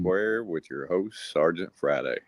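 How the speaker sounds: booming mock newscaster opening, then slow and measured; news anchor. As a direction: announce the show like a hammy TV anchor.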